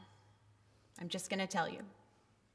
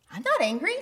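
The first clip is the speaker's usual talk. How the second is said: high, louder mock denial; pretended calm; 5 semitones higher, 5 dB louder, faster than usual. Woman unable to name her anger, brightly denying it. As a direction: insist innocently, voice lifting at the end. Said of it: "pretend that we're not angry".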